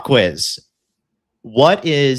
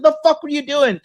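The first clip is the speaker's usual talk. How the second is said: much higher, a squealed mock yell at the dog; mock startled yelp; 14 semitones higher than usual. Shouting at the dog that bit him, squealed mock yelp. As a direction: yelp it like you just got bitten. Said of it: "you're like".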